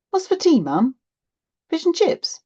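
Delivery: high, loud, hopeful questions; eager child. A narrator's reading. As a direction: kid asking mum what's for tea. Child eagerly asks about tea.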